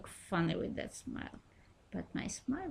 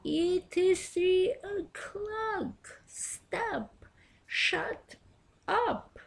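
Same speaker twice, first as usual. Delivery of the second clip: shouting; 10 semitones higher, much slower than usual. Retold or imitated. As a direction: yell at the door to stop.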